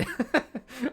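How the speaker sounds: short high-pitched giggle. Real, not acted. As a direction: break into a giggle. Laughs mid sentence.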